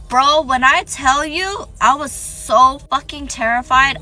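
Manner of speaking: loud, high, drawn-out storytelling emphasis; emphatic. Real, not acted. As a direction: hammer home how scary it was. Storyteller stresses how terrified she was.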